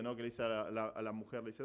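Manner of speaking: usual speaking voice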